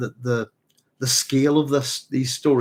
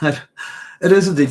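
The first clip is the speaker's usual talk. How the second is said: laughing, slow, and louder; 5 semitones higher, 8 dB louder, much slower than usual. Real, not acted. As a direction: laughs as the answer lands. Laughs, confirming chat's answer.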